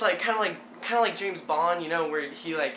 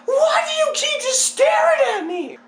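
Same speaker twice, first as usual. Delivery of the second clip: very high, loud exasperated mock shriek; hammed-up panic; 9 dB louder than usual. Hanging, to the staring neighbor, frantic mock shout. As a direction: yell in exaggerated desperation.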